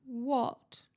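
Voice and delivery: put-on sulky ghost girl; a sullen one-word reply. A narrator's reading. Gloomy ghost answers a greeting, sulky. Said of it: "she said sulkily".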